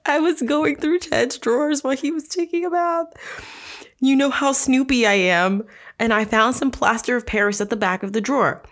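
mocking the memoir voice; mocking Liz. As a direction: parody her snooping confession. Rereads line in mock voice.

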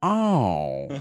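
long falling oh of belated realization; Eugene Levy. Shown as a demonstration. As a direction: do a slow double take in one word. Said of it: "this is my Eugene Levy impression".

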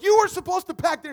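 high, loud accusing snap; blaming a friend. Acted. Disciple blaming another disciple, loud and accusing. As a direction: point the finger at him. Disciples argue back and forth.

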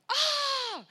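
high, loud mock toddler shriek; toddler scream. Mother imitating her son screaming, high loud shriek. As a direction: scream like a little boy being licked. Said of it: "he's screaming like".

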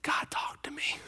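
breathless hush through tears; retold tearful whisper. Young man telling the pastor God spoke, teary whisper. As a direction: whisper it like he's just been crying. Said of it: "he comes to me bawling".

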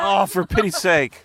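exasperated shout. Real, not acted. Exasperated reply to child.

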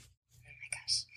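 slow breathless whisper. Real, not acted. Guest stunned by the slogan question, whispering. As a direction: whisper it, caught off guard.

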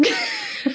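a high, breathy burst of laughter. Real, not acted. Friend laughing mid-sentence about K-pop, high burst of laughter.

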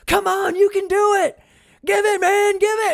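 high, loud yelling out the window; screaming encouragement. Retold or imitated. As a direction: shout him on, full voice. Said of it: "he's screaming at Dennis".

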